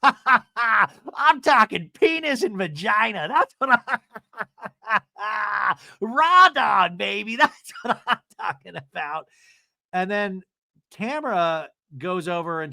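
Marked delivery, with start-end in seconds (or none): 0.0-9.3 words broken by high cackling; imitating her laugh. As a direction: mimic a woman cackling at her own crudeness. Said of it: "That's her laugh".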